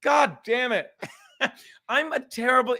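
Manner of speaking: normal talking voice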